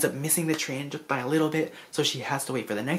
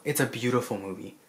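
usual speaking voice

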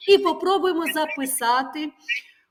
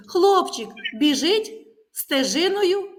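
slow dictation; 5 semitones higher, 5 dB louder, slower than usual. Shown as a demonstration. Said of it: "І так записуємо".

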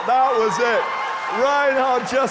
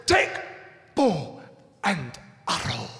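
word by word in a put-on accent; spaced-out accented pronouncement, dropping low at the end; measured mock-evangelist delivery with pauses; imitating Reinhard; 7 semitones lower, 4 dB quieter than usual. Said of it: "How did he say it?".

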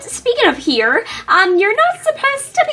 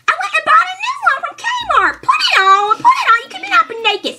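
exasperated mom; 10 semitones higher than usual. Acted. Mom orders Cameron to put his shirt on, exasperated. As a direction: push him into the shirt, fed up.

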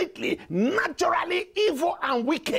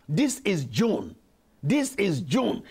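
ordinary delivery

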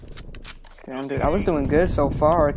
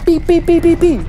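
loud high mock beeping; beep imitation; 14 semitones higher, 9 dB louder than usual. Real, not acted. Mimicking the game's beeping device loudly. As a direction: beep it out like an alarm.

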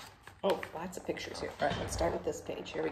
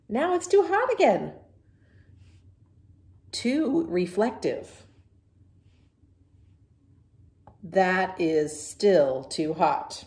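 loud, whiny string of complaints; fussy pigeon; 2 semitones higher, 12 dB louder, slower than usual. Acted. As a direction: gasp and fuss at every little thing.

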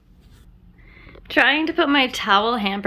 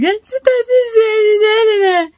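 high, loud hammed-up wail; mock crying; 11 semitones higher, 7 dB louder than usual. Imagining herself crying in a podcast interview, hammed-up sob. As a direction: blubber through the words like a weepy interviewer. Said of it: "I'd be crying".

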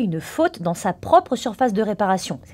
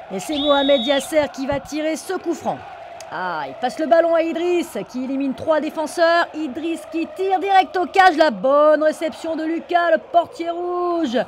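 raised live match call; excited sports commentary; 6 semitones higher than usual. Acted. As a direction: call the save like TV. Commentary of the free kick.

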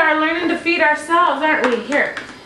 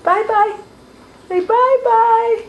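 high sing-song bye-bye; 8 semitones higher than usual. Real, not acted. Prompting goodbye to camera.

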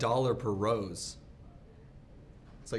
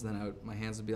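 ordinary talk, nothing marked

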